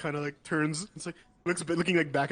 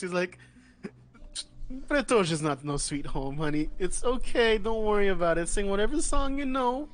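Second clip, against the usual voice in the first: Sandy's voice; 3 semitones higher, 5 dB louder than usual. Acted. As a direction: reassure her, honey-sweet. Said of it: "And she's like".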